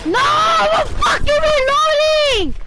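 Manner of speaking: high, slow, strained shout; frantic yell. Real, not acted. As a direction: yell it out mid-fight, panicking. Frantic mid-fight.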